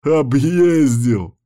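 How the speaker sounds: mocking. A narrator's reading. Friend keeping up the teasing, mocking loudly. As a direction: stretch the word gleefully to needle him. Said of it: "продолжал стебаться друг".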